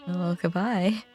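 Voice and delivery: normal talking voice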